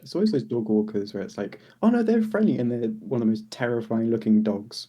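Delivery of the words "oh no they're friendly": mock cheery owner. Dog owners mimicked.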